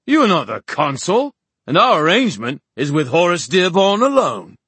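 high, loud imperious prince; Oban, haughty. Acted. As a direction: protest with haughty indignation. Story figure, haughty prince.

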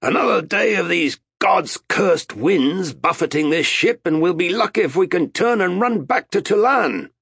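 high, loud complaint over the wind; shouted. A narrator's reading. Captain to Arutha as the ship heels, shouted. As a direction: yell it through the storm. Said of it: "he shouted to Arutha".

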